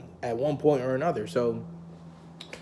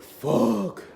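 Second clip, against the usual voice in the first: louder, higher drawn-out curse; dismayed curse; 8 semitones higher, 4 dB louder than usual. Retold or imitated. Student realising she has got him expelled, dismayed. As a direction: let out a loud, dismayed curse. Dismayed reaction in retold story.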